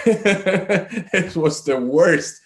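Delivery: high slow laugh into the words. Real, not acted. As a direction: laugh it off, rueful. Laughs recalling awful talk.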